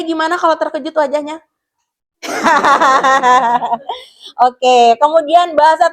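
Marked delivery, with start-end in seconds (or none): 2.2-3.9 long burst of amused laughter; real laugh. Real, not acted. Teacher watching a pupil act surprised, laughing. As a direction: laugh heartily for a moment.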